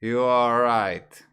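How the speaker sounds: slow, loud "You are right", each word apart; full careful form. Shown as a demonstration. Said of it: "the way you pronounce it is you're saying".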